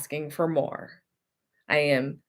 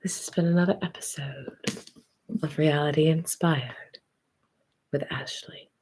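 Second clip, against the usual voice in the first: whispered sign-off. Real, not acted. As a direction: breathe the goodbye close to the mic.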